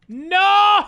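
a sudden, high, loud cry of dismay; anguished shout. Real, not acted. Streamer seeing the sim result, real anguished shout. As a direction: yell it out as the sim ruins your series.

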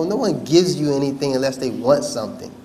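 ordinary delivery

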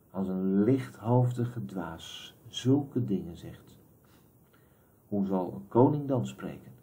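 soft, slow muttered aside; muttering to himself. A narrator's reading. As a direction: grumble it under your breath. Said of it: "Senja mompelde tot zichzelf". (zacht en traag voor zich uit gemompeld)

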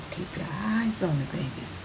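very soft, drawn-out coaxing; hushed. Real, not acted. Cook telling babies to watch the dish, hushed. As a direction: murmur it slowly, savouring the view.

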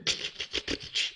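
quick voiceless stapler clicks; soft repeated mouth-made clicks; stapler noise. Acted. Fergarina holds up a stapler, imitated clicking.

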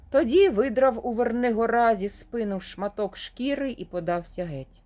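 ordinary delivery, nothing marked

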